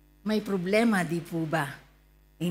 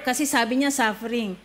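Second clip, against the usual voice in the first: ordinary talk, nothing marked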